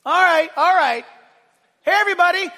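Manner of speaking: loud, high call to the crowd; grab the crowd's attention; preacher imagining Jesus hushing the crowd. Pretend.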